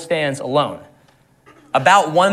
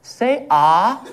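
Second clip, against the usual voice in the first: high little-girl command, lightly voiced; imitating his toddler daughter; 5 semitones higher than usual. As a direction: play the bossy toddler checking his mouth. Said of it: "she goes".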